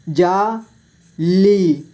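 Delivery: जाली split slowly into जा, ली; syllable by syllable. Shown as a demonstration. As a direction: stretch and separate जा and ली in जाली. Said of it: "इस तरह से आपको". (जाली को तोड़कर धीरे: जा, ली)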